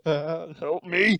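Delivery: acted gasping plea for help; gasp, then plead as if in agony; hammed-up pained cry; writhing in pain. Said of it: "writhing in pain. He's just sitting there like".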